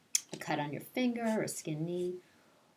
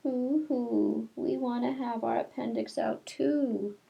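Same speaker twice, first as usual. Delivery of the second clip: higher, playful fake boo-hoo; mock crying children; 6 semitones higher, 4 dB louder, slower than usual. The little girls crying to Miss Clavel, mock boo-hoo. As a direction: whimper it like jealous little girls. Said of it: "all the little girls cried".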